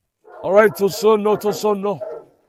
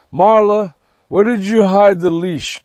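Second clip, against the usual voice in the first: ordinary talk, nothing marked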